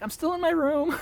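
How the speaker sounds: put-on very high, cheeky child reply; high childish retort; little kid voice. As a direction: answer like a literal-minded little kid. Child answering parents cheekily.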